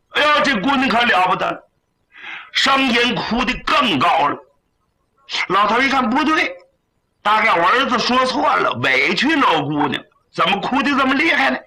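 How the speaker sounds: hurried, rising storyteller voice; excited narration. A narrator's reading. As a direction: rattle it off as the crying gets worse.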